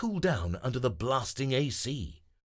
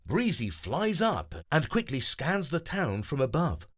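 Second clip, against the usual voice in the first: usual speaking voice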